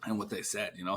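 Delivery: normal talking voice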